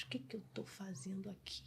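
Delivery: bewildered whisper to oneself; voiceless, hushed self-questioning; whispered inner thought. Retold or imitated. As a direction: whisper it like a private panicked thought. Retelling her bewildered inner thought.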